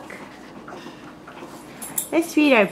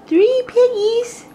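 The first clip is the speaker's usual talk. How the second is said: surprised question; 7 semitones higher, slower than usual. Acted. Mother asks baby excitedly.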